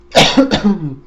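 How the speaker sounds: a harsh, loud cough from choking; loud real cough; real coughing fit. Real, not acted. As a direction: cough hard like water went down the wrong pipe. Choking on water.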